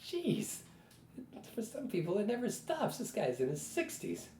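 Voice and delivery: inner exclamation. Retold or imitated. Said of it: "I remember sitting there thinking".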